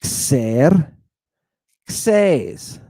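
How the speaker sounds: Xerxes as "kser... kses", two parts with a gap; split into syllables. Shown as a demonstration. Teacher sounding out a Greek name for the class, syllable by syllable. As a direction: sound out Xer, Xes one syllable at a time. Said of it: "It's two syllables".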